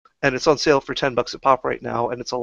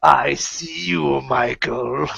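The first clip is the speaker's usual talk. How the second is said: slow, menacing monster voice; horror monster voice; much slower than usual. Retold or imitated. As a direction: stalk them slowly like a horror movie killer. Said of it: "horror movie quotes and chasing people down and be like".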